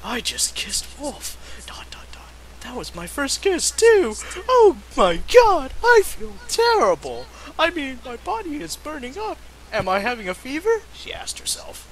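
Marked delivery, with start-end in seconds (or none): 0.0-2.2 unvoiced hush; dramatic whisper. Reader whispers the confession from the fanfic. 2.6-10.8 put-on much higher, fast, fluttery girl; flustered girl voice. Witch frets to herself, in a squeaky flustered voice.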